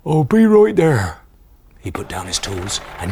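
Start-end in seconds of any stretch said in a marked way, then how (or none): 0.1-1.2 loud farmer voice. Acted. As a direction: speak up like a farmer. Farmer Jolly voice.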